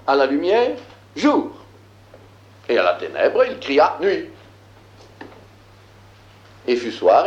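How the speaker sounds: measured, declaiming biblical verse; solemn scripture reading. Acted. Teacher reading Genesis aloud to the class, solemn scripture reading. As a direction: read it like sacred text, weighty.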